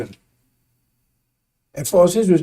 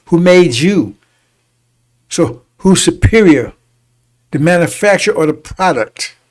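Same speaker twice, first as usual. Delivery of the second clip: louder, emphatic pulpit preaching; preaching at full force; 6 dB louder than usual. Real, not acted. Preacher tells brothers and sisters who is superior, loud preaching. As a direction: proclaim it to the congregation, building.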